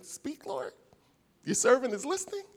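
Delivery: high whispered peek-one-eye plea; peeking nervous whisper. Retold or imitated. Preacher imitating Samuel peeking with one eye, high whisper. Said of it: "One eye open".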